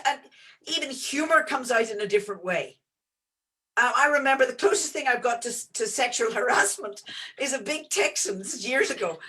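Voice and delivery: ordinary talk, nothing marked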